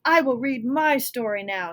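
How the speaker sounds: loud, booming giant voice; uppercase giant. Acted. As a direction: announce it like a giant. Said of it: "the Giant is talking in all uppercase".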